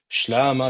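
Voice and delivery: "Shlama" said SHLAH-mah; "Shlama" as one of several careful variants; Shlama pronunciation variant. Shown as a demonstration. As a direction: say "Shlama" with sh run straight into l. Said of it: "whether it's pronounced as".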